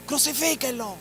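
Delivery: mock crowd yell. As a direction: call it out like the mob demanding his death. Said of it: "estaban gritando".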